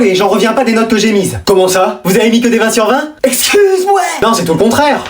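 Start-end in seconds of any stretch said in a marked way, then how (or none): 3.2-4.0 indignant outburst. Acted. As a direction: shoot it out shocked and offended.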